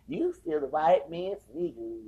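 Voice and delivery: gloating, amused put-down; slow mocking taunt. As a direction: rub it in slowly. Mocking taunt after cackle.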